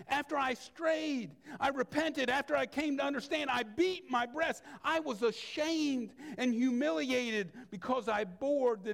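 fervent preached lament; pleading cry. Acted. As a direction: plead it as Ephraim's cry to God. Ephraim's cry from Jeremiah.

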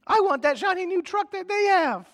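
mock five-year-old wanting a toy. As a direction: whine like a greedy little kid. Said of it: "We sound just like a five-year-old".

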